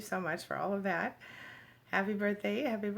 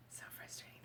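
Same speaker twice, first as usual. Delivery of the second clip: frustrated whisper; 19 dB quieter than usual. Real, not acted. Buffering trouble on her livestream.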